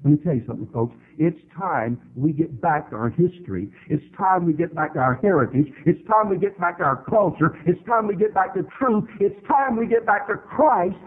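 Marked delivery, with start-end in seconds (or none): 1.2-11.0 rising rallying call. Acted. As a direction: build the refrain toward a shout.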